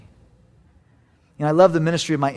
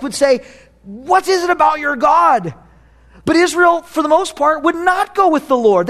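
loud, higher preaching voice; fervent preaching; 9 semitones higher, 5 dB louder than usual. Acted. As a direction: preach it with full fire. Preaching at full force.

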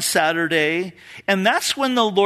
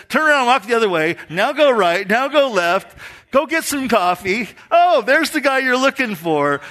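lively retold directions; 8 semitones higher, 5 dB louder than usual. Voicing God's step-by-step directions.

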